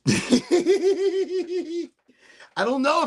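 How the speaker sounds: high laugh. Real, not acted. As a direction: bursts out laughing. Laugh heard.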